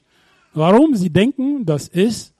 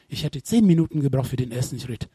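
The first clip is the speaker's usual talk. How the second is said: ordinary talk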